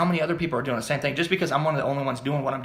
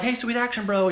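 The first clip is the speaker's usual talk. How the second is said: fan greeting; 10 semitones higher than usual. Retold or imitated. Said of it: "people are like".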